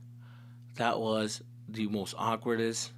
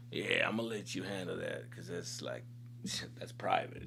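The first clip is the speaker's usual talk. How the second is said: soft, hushed, sheepish retreat; backing off quietly; 8 semitones lower, 9 dB quieter than usual. Retold or imitated. Homie stepping back from his friend's angry dad, hushed and sheepish. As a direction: quietly back away from the trouble.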